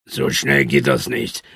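very low, grumbling captain; deep murmur. A narrator's reading. Captain slows impatient Franka, murmuring very low. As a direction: murmur it far down in your register. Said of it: "murmelte Adiaba".

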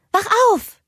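put-on high, loud, eager child; little girl voice. A narrator's reading. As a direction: wake your sister, bouncing with excitement. Anna wakes her sister. (hohe, aufgeregte Kinderstimme)

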